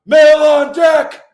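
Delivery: slow, high, loud call out; drawn-out formal shout; loud announcement. Retold or imitated. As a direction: bellow it down the passageway. Calling into the female barracks.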